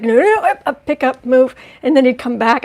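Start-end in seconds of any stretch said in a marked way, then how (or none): 0.0-1.5 retold commands to the puppy, urgent; mock urgent no. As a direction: shoo the puppy off, alarmed. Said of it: "He was like".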